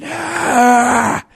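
sudden high, loud pained "Ah"; anguished shout breaking free; raw cry of release. Real, not acted. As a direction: let out everything held in one cry. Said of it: "just going".

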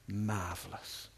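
slow, soft, low murmur; weak last word. Retold or imitated. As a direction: imitate a faint dying word, drawn out. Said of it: "We whispered in her ear".

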